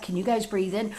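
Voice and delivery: ordinary delivery, nothing marked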